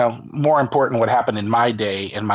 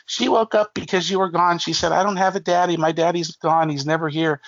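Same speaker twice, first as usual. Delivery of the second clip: higher, lightly voiced wife retelling; a hint of the wife's higher voice; wife relaying; 8 semitones higher than usual. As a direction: pass on the child's words lightly. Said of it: "And she's like".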